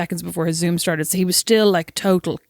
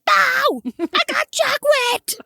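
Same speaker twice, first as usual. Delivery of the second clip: put-on much higher, slow, laughing kid voice; blurting little kid; much slower than usual. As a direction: mimic the child giving it away. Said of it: "he went".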